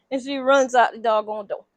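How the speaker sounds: plain reading voice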